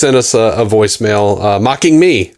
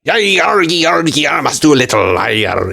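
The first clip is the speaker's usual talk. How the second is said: hammed-up high pirate Scottish brogue; 5 semitones higher than usual. Shown as a demonstration. Reenacting last episode's insult to Stu, mock pirate Scottish. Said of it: "You gave him pirate Scottish voice".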